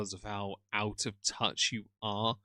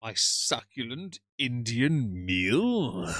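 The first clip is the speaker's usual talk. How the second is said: very slow, savouring every word; savouring drawl; 3 dB louder, much slower than usual. Acted. Dreaming aloud of a curry dinner, slow and savouring. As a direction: relish each word like a tasty bite. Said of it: "I want to enjoy my meal".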